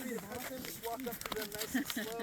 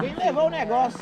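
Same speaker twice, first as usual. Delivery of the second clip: calling out; 6 semitones higher, 17 dB louder than usual. Real, not acted. Calling across the crowd.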